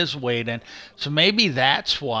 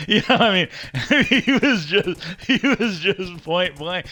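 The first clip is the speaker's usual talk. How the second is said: laughing; 5 semitones higher, 4 dB louder than usual. Real, not acted. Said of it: "laughing through it".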